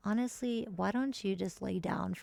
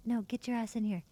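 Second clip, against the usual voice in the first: usual speaking voice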